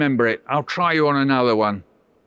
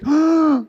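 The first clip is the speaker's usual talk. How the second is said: loud high mock gasp; shocked gasp; 12 semitones higher, 5 dB louder than usual. Shown as a demonstration. Host demonstrating the boy's big gasp. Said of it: "He went like this".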